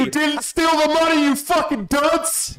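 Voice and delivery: high, drawn-out exasperated yell. Real, not acted. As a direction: let the frustration burst out. Exasperated outburst at friend.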